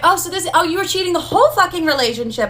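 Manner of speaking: high, rushed retold accusation; outraged confrontation. Confronting her ex over cheating, retold outrage.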